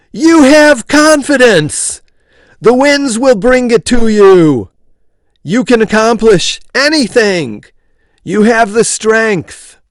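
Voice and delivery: high, loud slow proclamation; yelling. A narrator's reading. Hale yelling out the window to the hemisphere, loud. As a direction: bellow it out the window to the world. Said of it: "yelled out the open window".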